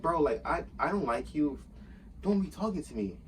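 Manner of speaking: going in on him. Retold or imitated. Worker tells manager to stop talking to him, going in. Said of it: "I was really going in".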